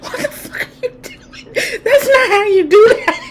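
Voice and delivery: high, laugh-soaked voice of Lucifer; laughing impression. Lucifer scolding her summoning, voiced while crying with laughter. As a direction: squeak it out through tears of laughter. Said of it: "he was like".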